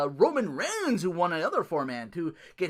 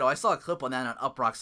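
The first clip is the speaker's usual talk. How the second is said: ordinary talk, nothing marked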